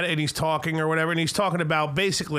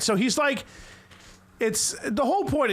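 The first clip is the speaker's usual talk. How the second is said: ordinary talk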